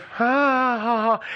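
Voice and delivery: high, put-on "ha, ha, ha"; imitated audience laugh. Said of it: "the audience would at first kind of".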